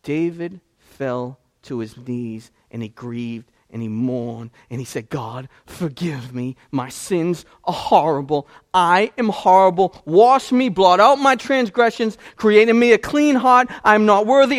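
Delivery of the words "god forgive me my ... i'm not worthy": much louder, higher cry for mercy; anguished plea. Retold or imitated. David on his knees begging God, loud anguished cry. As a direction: cry out like a broken king. David's repentance prayer voiced.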